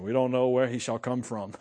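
low-pitched, mock-mystical pronouncement; mock mysticism. Leaders arguing about Messiah's origin, mock-mystical. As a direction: imitate someone suddenly turning mystical. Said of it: "mysticism all of a sudden".

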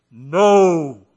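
big, booming, drawn-out voice; God. Acted. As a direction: thunder it out, long and loud. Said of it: "That's what I imagine God would sound like".

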